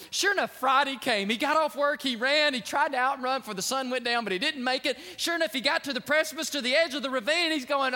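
loud, driving sermon narration; booming pulpit storytelling; preaching at full force. Acted. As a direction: push the story toward the ravine. Preacher narrates the frantic run.

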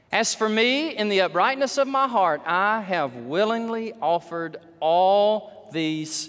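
measured, ringing pulpit declamation; impassioned scripture proclamation. Acted. Preacher reads David's offering prayer to church, proclaiming loudly. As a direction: proclaim it loud and high.

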